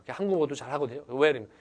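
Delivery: normal talking voice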